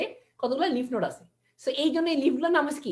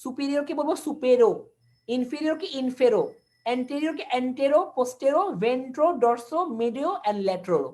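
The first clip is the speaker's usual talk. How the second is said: terms said slowly one by one while writing; slow board dictation; much slower than usual. Shown as a demonstration. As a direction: read out each combined form slowly. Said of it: "দেখেন আমি লিখে দিচ্ছি এখানে".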